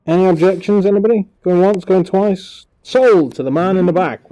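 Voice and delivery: loud, high auctioneer calling bids. Pretend. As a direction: rattle it off like a gavel auction. Mock auction for stream chat.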